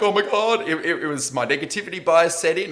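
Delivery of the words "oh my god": high, loud burst of alarm; panicked gasp. Retold or imitated. Fan panicking as his team's lead slips, high and loud. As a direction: relive the moment the nerves hit. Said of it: "'Cause it felt like".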